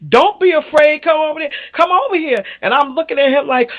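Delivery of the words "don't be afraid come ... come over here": much higher, coaxing call; higher mocking imitation of the big guy; imitating the date. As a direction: mimic him beckoning her over. Date calling her over.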